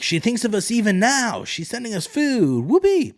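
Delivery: high, squeaky mock-grateful peasant voice; mock cheering peasants. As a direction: put on a silly high voice for the cheering crowd. Small folk cheering Rhaenyra's food.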